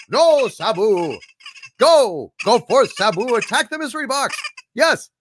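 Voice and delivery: high, excited urging of a pet into battle; hammed-up order to attack; mock battle command. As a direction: sic him on the box, hammed up. Commanding pet squirrel theatrically.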